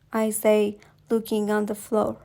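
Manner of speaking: ordinary delivery, nothing marked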